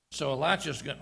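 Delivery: ordinary delivery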